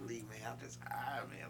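soft, breathy stoner whisper; a lazy whispered reply; mock whisper. Stoned coworker answers, whispered mock. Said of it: "He said".

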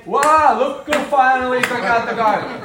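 high, loud sarcastic jab; mock him for finally doing it; loud snide sarcasm. Shown as a demonstration. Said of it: "Not like that".

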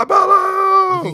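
very high, drawn-out shouted name; hammed-up shout. Mimicking Apollo's old persona, hammed-up hype shout. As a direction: holler it long and high like a hype man.

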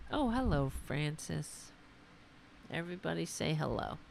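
hushed doggy hello; soft greeting to dog. Real, not acted. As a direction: greet the pup gently.

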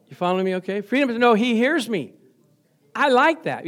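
ordinary delivery

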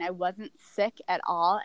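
ordinary talk, nothing marked

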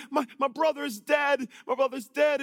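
high, loud mock lament; imitating distraught crying. Grieving sister tells Jesus of her brother, imitated crying. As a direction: cry it out as the bereaved sister, acted. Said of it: "she's crying".

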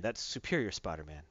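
self-important hero, retold in a low voice; haughty correction. Hero corrects the old woman.

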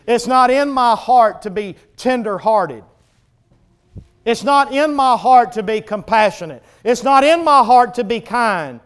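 loud preached admission of weakness; forceful confession. Acted. Preacher voices humble believer admitting weakness, forceful confession. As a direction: press each admission home.

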